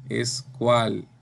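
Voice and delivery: ordinary talk, nothing marked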